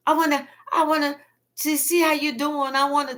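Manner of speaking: high, loud, overeager mock cheer; fake friendly invite. Liar loudly inviting the other to get together. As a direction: act falsely chummy and pushy. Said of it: "They was like".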